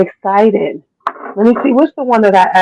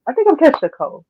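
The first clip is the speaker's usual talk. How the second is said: ordinary delivery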